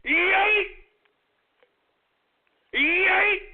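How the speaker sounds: high, punchy yelled yeet; hyped meme cry; yeet shout. Acted. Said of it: "what do the brothers say online".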